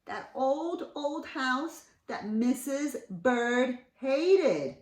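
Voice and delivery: plain reading voice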